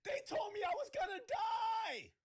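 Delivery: high, loud mock wail; mock crying claim. Mocking Desiree's claim to police, hammed-up crying shout. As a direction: ham up a sobbing victim.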